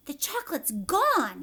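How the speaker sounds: loud amazed cry; shouted. Acted. Grandpa Joe after the flash, amazed shout. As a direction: shout it in disbelief. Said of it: "shouted Grandpa Joe".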